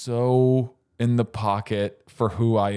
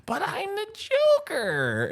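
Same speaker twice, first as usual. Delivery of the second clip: mock Joker. Retelling the cut scene, hammed-up Joker voice. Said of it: "he's like".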